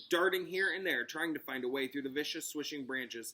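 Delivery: ordinary delivery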